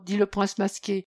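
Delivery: plain reading voice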